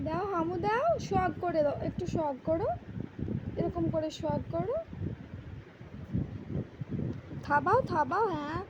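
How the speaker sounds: put-on coaxing singsong baby talk to a toddler. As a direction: cajole the little girl gently. (শিশুকে আদুরে সুরে ভোলানো)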